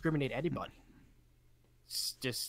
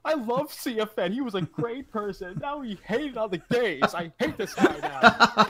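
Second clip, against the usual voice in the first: high, loud mock outrage; mock offended viewer; 9 semitones higher, 8 dB louder than usual. Imitating an offended chat viewer, high mock outrage. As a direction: play up an offended fan, pitched high. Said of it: "Someone was like".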